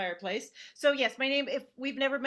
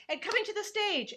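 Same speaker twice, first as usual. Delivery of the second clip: higher-pitched mock MC intro; hammed-up show introduction; stage introducer; 12 semitones higher than usual. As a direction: announce the next act brightly onstage, voice lifted high. Retelling announcer introducing her.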